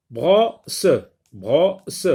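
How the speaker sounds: "brosse" cut into bro, pause, se; two separate beats on "brosse", bro rising; syllable by syllable. Shown as a demonstration. Teacher tapping syllables for pupils, "brosse" said bro... se. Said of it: "frappe les syllabes".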